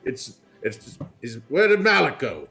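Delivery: loud, flustered gentleman voice; fussy old aristocrat. Acted. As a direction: bluster like a pompous lord of the house.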